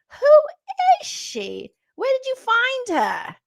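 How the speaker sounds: very high, slow squealing falsetto; mock disbelief. Host hamming up shock at Morgan's new persona. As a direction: stretch each word in shrill mock shock. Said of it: "I'm like".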